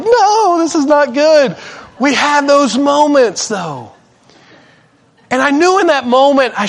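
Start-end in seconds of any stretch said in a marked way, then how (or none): 0.0-1.5 high, loud, hammed-up refusal; raised-voice mock wail; mock protest. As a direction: cry out in comic dismay. Retold prideful inner protest.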